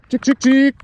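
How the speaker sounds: loud high chicken call. Real, not acted. Calling chickens on the farm.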